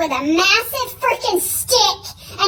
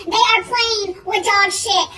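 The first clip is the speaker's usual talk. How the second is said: usual speaking voice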